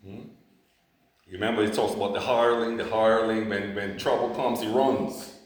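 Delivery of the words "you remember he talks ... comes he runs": loud, punched sermon delivery; a pastor proclaiming God's promise with fire; full-force preaching. Acted. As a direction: drive the promise home to the congregation. Preaches louder and faster.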